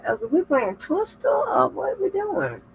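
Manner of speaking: high, baffled question. Retold or imitated. Confused in bed, high baffled question. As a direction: ask it like you can't believe it. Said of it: "I'm like".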